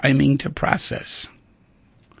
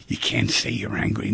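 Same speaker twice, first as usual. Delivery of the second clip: ordinary talk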